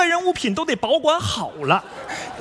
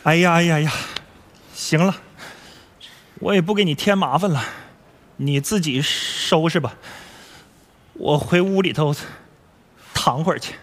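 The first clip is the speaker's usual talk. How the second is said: low, weary giving-up; dejected, low-pitched retreat; deflated resignation; 9 semitones lower than usual. Acted. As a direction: sigh it out and withdraw, much lower. Let-down father gives up and retreats.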